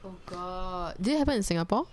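normal talking voice

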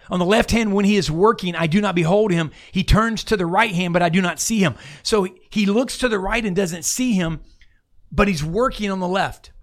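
impassioned preaching. Acted. Impassioned scripture reading.

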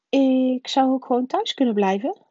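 put-on hopeful boy. A narrator's reading. Harry suggests staying home alone, hopeful.

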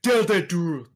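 put-on loud, high African accent line. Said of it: "he tries to do an African accent".

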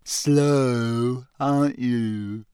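slow, mocking ghost voice; taunting ghost girl; teasing ghost. A narrator's reading. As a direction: tease him for being dim.

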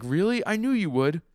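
high, loud, thrilled exclamation; excited delight. Retold or imitated. Gambler thrilled Sasuke will play, excited delight.

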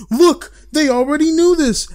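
loud, high-pitched panicky outburst; mock freak-out. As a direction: imitate people freaking out online. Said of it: "there's people freaking out like".

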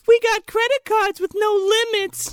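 very high creature voice; luring creature. Pretend. Dryer monster offering credit cards, very high. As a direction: tempt her like a monster.

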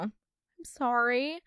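ordinary delivery

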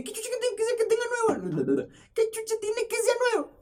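mock outraged squeak, way up high; squeaky high-pitched voice. Neighbor quarrel retold in a squeaky high voice. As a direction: shriek it in a squeaky falsetto. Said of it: "No con la voz de pito".

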